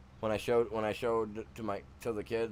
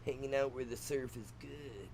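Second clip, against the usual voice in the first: lightly put-on surfer voice, softer; mock surfer talk; 3 semitones higher, 5 dB quieter than usual. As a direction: try out some hesitant surfer lingo. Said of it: "This sounds weird trying to talk like them".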